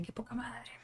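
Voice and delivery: breathy whisper under the breath. Retold or imitated. Thinking it at the director, a muttered whisper. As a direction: mouth it silently, not letting the director hear.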